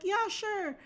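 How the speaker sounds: high, airy fake agreement; placating agreement. Placating the date again.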